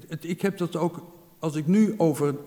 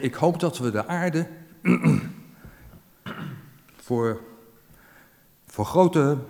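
choked up; slower than usual. Real, not acted. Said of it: "daar word ik altijd emotioneel van".

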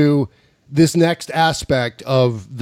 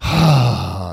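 long exhaled sigh; 5 semitones lower than usual. Real, not acted. Host resetting after the absurd exchange, long sigh. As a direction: let out a big, audible breath.

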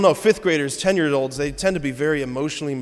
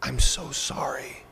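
slow breathless whisper, barely voiced; soft voiceless whisper of a girl's apology; mouthed whisper; 3 dB quieter, slower than usual. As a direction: mouth the words silently to comfort him. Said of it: "she just mouthed the words to me".

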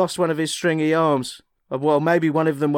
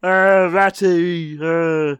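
slow, stretched puppet-rat voice, half-hearted; Roland Rat impression; 4 dB louder, much slower than usual. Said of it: "Do your Roland Rat impression".

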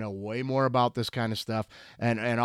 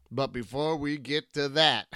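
ordinary delivery

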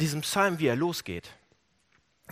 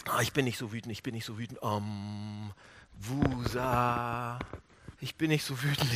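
gespielte Selbstberuhigung; 6 semitones lower, 4 dB quieter than usual. Retold or imitated. Imitating people suppressing anger.

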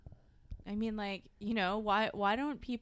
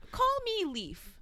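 mock high voice; 13 semitones higher, 4 dB louder, slower than usual. Jokey self-naming.

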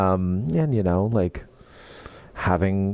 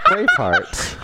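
laughing; slower than usual. Acted. Joke list, laughing high.

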